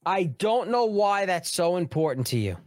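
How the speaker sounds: scornful echo of her line; incredulous repeat. Retold or imitated. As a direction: throw her words back in disbelief. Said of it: "repeats the governor's line".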